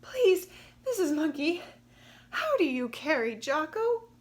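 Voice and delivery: high, loud squeaky plea; breathless squeak. Acted. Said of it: "she managed to squeak".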